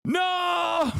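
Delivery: very high strained scream; mock meltdown. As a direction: scream like you've completely lost it, in jest. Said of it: "I'm losing my fucking mind a little bit".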